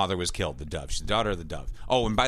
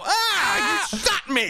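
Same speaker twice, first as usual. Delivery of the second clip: high-pitched, hammed-up yelp of pain; overacted "I've been shot" wail; mock wounded cry; 4 dB louder, much slower than usual. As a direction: imitate a badly acted gunshot victim. Mimicking the clip's gunshot victim.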